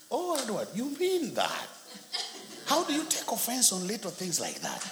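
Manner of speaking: high-pitched incredulous reaction; imitated amazed wife. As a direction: mimic her disbelief, playing for laughs. Imitates wife's amazed reply.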